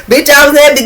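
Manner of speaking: laughing. Real, not acted. Laughing while recounting relief.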